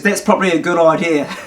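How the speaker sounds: said through a chuckle; laughing farmer. Retold or imitated. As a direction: let the laugh leak into the line.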